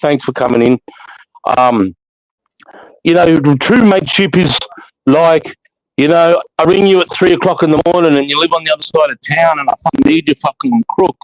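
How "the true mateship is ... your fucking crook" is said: ordinary delivery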